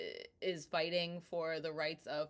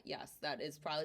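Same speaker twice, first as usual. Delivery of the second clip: usual speaking voice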